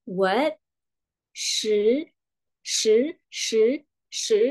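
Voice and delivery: "shi" climbing like "What"; rising second tone. Shown as a demonstration. Teacher shows the tone goes up, "shi" rising. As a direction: lift the end of every "shi". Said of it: "Ya kan, naik".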